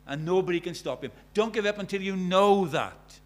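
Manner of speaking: loud, urgent exhortation; full-force preaching. Acted. Preacher urging listeners not to give up until they find him, impassioned preaching. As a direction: drive the appeal home with punched words.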